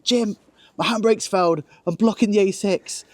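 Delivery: high, hurried plea for help; panicked call to Jim. Retold or imitated. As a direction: ring a mate in a panic at dawn. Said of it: "at six o'clock on a Sunday morning going".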